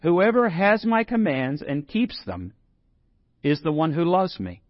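"Whoever" leaned on, slow re-reading; slow down and hit "Whoever"; emphatic slow re-read of the verse; stress on Whoever. Shown as a demonstration. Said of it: "let's read it again".

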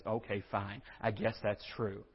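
low, soft concession; grudging acceptance. Acted. Preacher voices someone finally accepting the truth, grudgingly. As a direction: give in quietly, as if out of arguments. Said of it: "it's then accepted as".

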